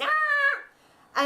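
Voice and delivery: very high, drawn-out "aaah"; mimicked yell. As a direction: re-enact the long cry without extra volume. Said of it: "and went".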